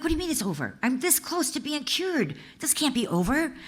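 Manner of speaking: high-pitched mock disbelief, retold; a retold plea of disbelief, pitched well up; disbelieving protest. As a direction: protest the news as if stunned. Protesting her trial ending.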